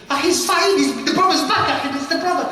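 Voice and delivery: high, loud interjection; acted urgent protest. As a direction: call out urgently to stop them. Acting out alarmed onlooker stopping a beating.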